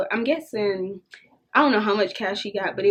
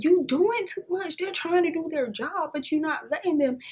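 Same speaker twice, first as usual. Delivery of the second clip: exasperated scolding; 7 semitones higher than usual. Retold or imitated. Scolding friend at restaurant.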